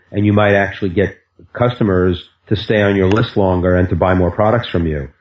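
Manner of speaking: ordinary talk, nothing marked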